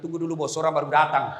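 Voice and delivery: ordinary talk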